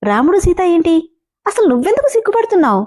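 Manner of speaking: high, fast, puzzled outburst; confused and raising his voice; baffled. A narrator's reading. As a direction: rattle it off high in disbelief. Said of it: "అయోమయంగా అడిగాడు".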